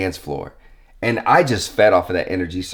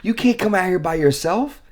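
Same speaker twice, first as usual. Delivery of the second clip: scolding guard; 10 semitones higher than usual. Retold or imitated. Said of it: "He's like".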